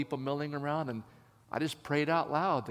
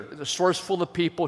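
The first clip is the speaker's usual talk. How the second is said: usual speaking voice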